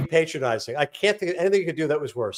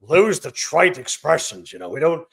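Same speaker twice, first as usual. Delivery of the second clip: imitating Vince; 4 dB louder, slower than usual. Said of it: "he's like".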